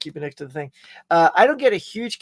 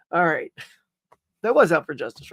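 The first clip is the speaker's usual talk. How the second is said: normal talking voice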